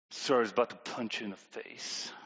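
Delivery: a hint of a low, grudging child; sulky kid. Tommy sulkily apologizing to his sister. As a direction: sullen half-hearted sorry.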